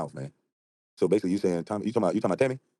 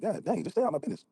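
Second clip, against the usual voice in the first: mock woman; 10 semitones higher than usual. Mocking a Southern woman.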